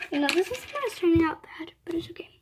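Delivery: hushed ASMR whisper. Shown as a demonstration. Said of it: "I'm just gonna do some A S M R".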